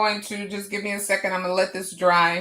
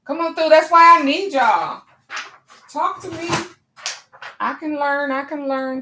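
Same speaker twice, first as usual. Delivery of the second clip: high, loud hype at the chat; rally the viewers, pumped up; cheering on the chat; hyped cheering; 8 semitones higher, 6 dB louder than usual. Real, not acted.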